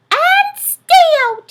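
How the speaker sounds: tiny mouse laying down the law; squeaky mouse, firm. A narrator's reading. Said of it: "he said".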